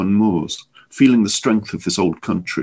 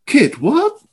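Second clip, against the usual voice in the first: shocked; 3 dB louder than usual. A narrator's reading. Shocked at blood.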